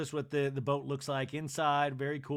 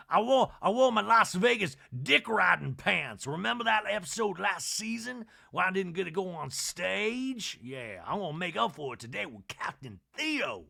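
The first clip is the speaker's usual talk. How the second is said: mock Sutton; 5 semitones higher, 5 dB louder than usual. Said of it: "Sutton talking head".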